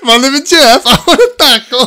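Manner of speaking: high, loud mock Italian accent. Comic clowning for his friend at the taco shop, mock Italian accent. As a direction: ham up a laughing, high-pitched Italian accent. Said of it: "Why are you saying an Italian accent".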